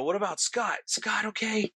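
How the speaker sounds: high mock scream, little extra volume; retold scream. Teller asking after friend Scott, mock screaming. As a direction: pitch it up like calling out in alarm. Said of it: "I, I'm screaming".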